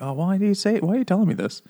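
high-pitched mock dismay; dismayed protest. Husband asking why he's told this, mock dismay. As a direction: protest in a high, pained imitation.

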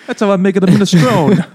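mock Italian accent through a laugh; mock Italian chef. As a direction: ham it up like a cartoon Italian cook. Joke riffing on the Italian character.

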